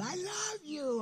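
put-on high, slow little boy's voice; tender child voice. Grandson telling grandpa he loves him, high slow child voice. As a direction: say it softly like a five-year-old.

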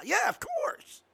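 sarcastic. Acted. Sarcastic jab at believers.

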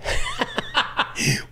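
high burst of real laughter; sudden high laugh at the joke. Real, not acted.

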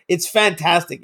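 ordinary talk

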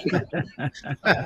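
soft, gleeful chuckle; real chuckle. Real, not acted. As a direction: chuckle quietly at your small victory. Amused at having annoyed superiors.

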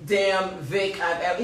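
ordinary talk

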